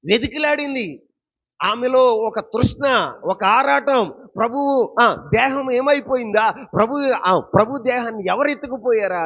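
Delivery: fervent preaching. Acted. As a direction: pour out her longing to the church. Preaching Mary's longing loudly.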